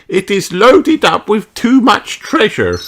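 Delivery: high, loud, knowing fairy nanny; Nanny Plum's voice. Acted. Nanny Plum explains why the ship leans.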